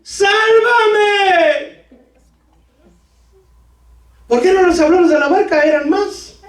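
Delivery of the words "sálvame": high, crying plea of a sinking man; Peter crying out. Retold or imitated. Peter sinking, crying out to the Lord, high and pleading. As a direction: beg the Lord to save you, voice pitched up and crying. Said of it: "gritó, diciendo".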